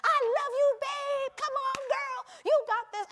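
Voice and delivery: high, loud encouraging cheers; root for her like a coach; cheering husband. Retold or imitated. Husband cheering his wife through her challenge, high and loud. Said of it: "he's over there".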